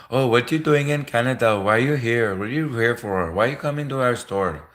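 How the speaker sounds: hammed-up Indian shopkeeper accent. Indian at the Canadian border asking why you came, mock Indian accent. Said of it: "that was partly the Quickie Mart".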